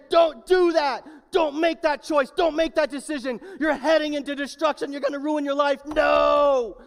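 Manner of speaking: high, loud, urgent shouting; yelling a warning. Acted. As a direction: yell like you're stopping a friend from disaster. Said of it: "willing to yell and say".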